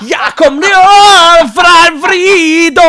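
loud, very slow mock Russian singing; over-the-top fake Russian accent crooning. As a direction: belt gibberish in a hammy Russian accent. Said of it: "c'est mon accent".

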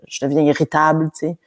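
normal talking voice

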